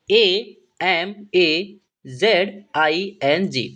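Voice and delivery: slow, spaced-out spelling; letter by letter. Shown as a demonstration. Teacher spelling the next word for students, letter by letter. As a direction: give every letter its own beat.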